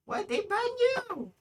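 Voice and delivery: high, stunned disbelief; shocked squeal. Real, not acted. Shocked reaction to friend's ban.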